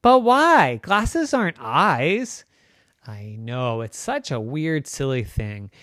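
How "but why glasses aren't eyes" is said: high, loud then slow; puzzled objection to mom; protesting child. Acted.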